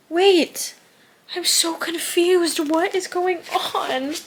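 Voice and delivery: high, drawn-out bewilderment; baffled. Real, not acted. Reader reacting to a confusing plot twist, baffled. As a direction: stretch it out, high and thrown.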